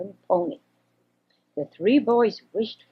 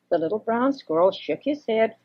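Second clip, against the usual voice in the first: usual speaking voice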